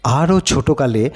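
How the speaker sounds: ordinary delivery, nothing marked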